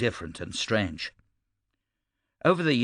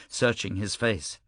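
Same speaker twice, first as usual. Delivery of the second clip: ordinary delivery, nothing marked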